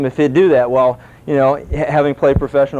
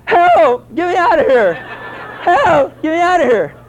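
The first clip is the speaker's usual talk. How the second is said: high, piercing cry for help; screaming; 12 semitones higher, slower than usual. Retold or imitated. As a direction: scream for help, trapped and terrified. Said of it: "this guy starts screaming".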